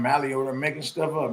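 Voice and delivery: ordinary delivery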